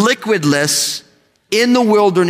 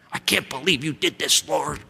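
grumbling to God; 4 semitones lower, 8 dB quieter than usual. Retold or imitated. Mock complaint to God.

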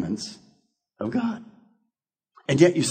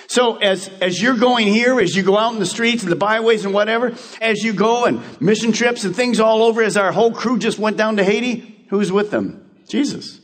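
higher, driving sermon build to a question; building preacher call; 7 semitones higher than usual. Acted. Pastor asks the church who goes with the Haiti crew, preaching. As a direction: build it up and land on Jesus.

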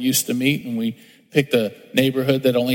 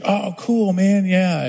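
higher, easygoing neighbour's cheery reply; friendly surprise; 6 semitones higher, slower than usual. Retold or imitated. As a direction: toss it off like a relaxed neighbour. Neighbour answers the invitation.

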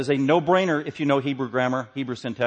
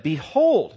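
BEHOLD called out higher and louder; emphatic; 4 semitones higher, 6 dB louder than usual. Acted. Preacher voices Moses introducing God's word, emphatic BEHOLD. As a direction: punch BEHOLD as an attention-grabbing interjection. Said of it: "It's an emphatic word".

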